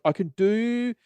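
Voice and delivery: high, slow generous offer; smug salesman. Retold or imitated. Salesman granting a price, high and slow. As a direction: draw it out like a favour. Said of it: "they're like".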